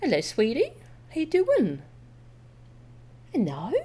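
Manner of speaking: ordinary talk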